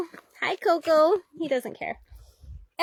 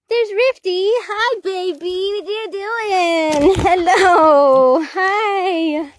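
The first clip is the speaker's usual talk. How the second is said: loud, high, drawn-out coo to a dog; loud doting pet voice; 4 semitones higher, 7 dB louder, much slower than usual. Real, not acted. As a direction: greet a beloved dog with stretched vowels. Gushing greeting to her dog.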